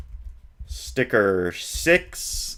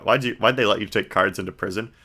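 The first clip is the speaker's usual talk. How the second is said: ordinary talk, nothing marked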